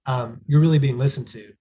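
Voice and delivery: normal talking voice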